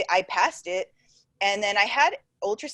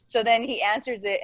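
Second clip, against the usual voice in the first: usual speaking voice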